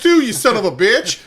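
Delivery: loud, high-pitched needling; exasperated outburst. Real, not acted. Arguing with co-host over the plugs, exasperated. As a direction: snap it loudly at your co-host.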